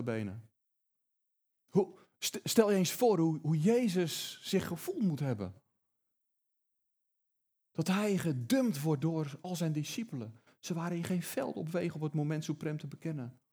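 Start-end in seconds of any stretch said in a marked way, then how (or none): none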